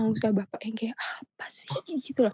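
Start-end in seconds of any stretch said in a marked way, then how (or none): 0.9-1.7 sangat pelan, nyaris tanpa suara; meniru gerutu berbisik. Retold or imitated. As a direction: bisikkan seperti protes yang ditahan. Meniru reaksi kesal pada guru.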